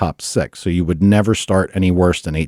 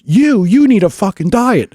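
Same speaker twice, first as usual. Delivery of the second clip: high, loud snapped insult; retort; 13 semitones higher, 4 dB louder than usual. Retold or imitated. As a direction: fire it back at the heckler. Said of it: "Brian looks up and he goes".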